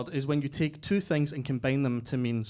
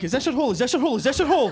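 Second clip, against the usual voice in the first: mock eager repeat; 10 semitones higher, 8 dB louder, faster than usual. Bulgarian guy's bedroom line retold.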